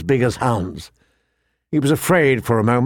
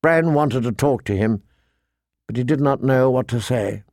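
ordinary delivery, nothing marked

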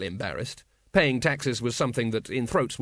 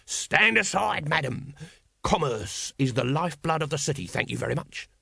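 pushy street vendor; 3 semitones higher than usual. Acted. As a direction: clear a way like a hawker.

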